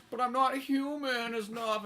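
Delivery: mock self-pity. Mocking the tragic cyborg cliché, slow pitiful wail. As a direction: draw it out as a hammed-up tragic moan. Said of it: "one's like".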